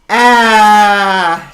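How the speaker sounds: loud short ah from the belly; saying ahh. Shown as a demonstration. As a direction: blast out a quick ah. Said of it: "maybe you want that sound".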